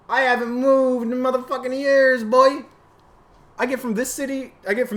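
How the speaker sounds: loud, high, furious shouting; ranting yell. Real, not acted. Ranting at viewers about cars, yelling hard. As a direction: yell the rant straight at the camera. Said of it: "I'm just yelling at a fucking camera".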